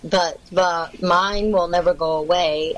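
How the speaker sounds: ordinary delivery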